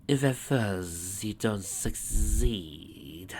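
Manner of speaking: very slow, soft sinister voice; sinister taunt. Acted. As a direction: taunt slowly and darkly.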